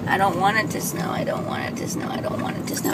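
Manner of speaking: unvoiced whisper, dropping away. Real, not acted. Telling viewers she doesn't want snow, whispered. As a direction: breathe it out, barely there.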